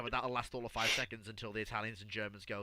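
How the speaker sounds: ordinary talk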